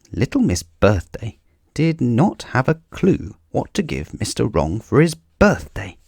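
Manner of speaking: ordinary delivery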